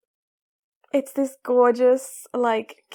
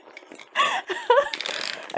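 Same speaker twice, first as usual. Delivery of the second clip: high giggles round the words; giggly excitement. Real, not acted. Unpacking the filament, high giggling. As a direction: giggle through it, can't contain it.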